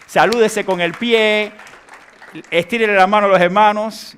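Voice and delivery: voz fuerte y aguda para toda la congregación; anuncio en voz alta. Real, not acted. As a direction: proyecta las instrucciones por encima de la música. Calls instructions to congregation over music. (llamado fuerte a los fieles)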